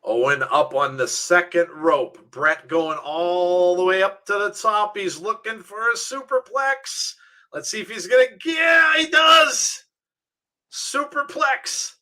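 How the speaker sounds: excited call rising to a high shout; wrestling commentary climbing in pitch to a peak; play-by-play. Real, not acted. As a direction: build suspense, then explode on the big move. Calls the match play-by-play.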